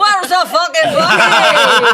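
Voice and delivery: put-on drawn-out drunken holler; loud slurred shout. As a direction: yell it slow like a drunk. Mock drunk shouting for bucket.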